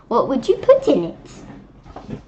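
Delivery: ordinary delivery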